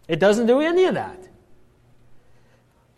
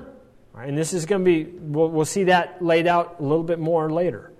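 ordinary talk, nothing marked

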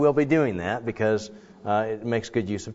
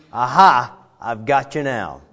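mock gloating, louder on aha; gotcha taunt; 7 dB louder than usual. Preacher mimicking judgment as a taunt, mock gloat. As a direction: caught you, gleefully. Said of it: "It sounds like it means".

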